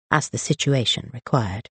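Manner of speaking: ordinary delivery, nothing marked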